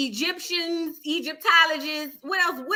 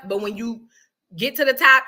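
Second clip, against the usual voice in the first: normal talking voice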